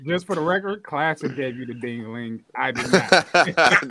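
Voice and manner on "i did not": laughing. Real, not acted. Laughing denial of a joke.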